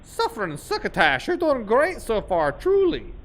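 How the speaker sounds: goofy cartoon voice. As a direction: ham up the cartoon cheer.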